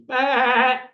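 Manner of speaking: imitated sheep bleat. Joking about what they say of Wales, mock sheep bleat.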